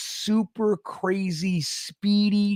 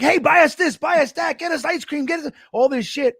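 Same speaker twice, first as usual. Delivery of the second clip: high, loud eager demands; greedy kids demanding; 7 semitones higher, 4 dB louder than usual. Retold or imitated. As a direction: kids cashing in on a friend. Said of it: "We're just like".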